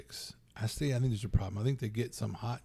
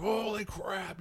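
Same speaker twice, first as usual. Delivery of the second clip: high-pitched startled outburst; exaggerated, high "holy crap"; mock shock; 9 semitones higher, slower than usual. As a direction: act startled by a movie scare. Acted viewer shock at a scare.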